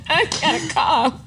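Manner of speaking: slow, laughing echo. Real, not acted. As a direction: laugh it out while teasing. Laughing, echoing the friend.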